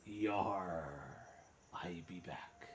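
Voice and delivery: lightly put-on pirate reading; pirate accent. Streamer reading a 1700s note aloud, pirate accent. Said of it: "so I'm speaking in a pirate's accent".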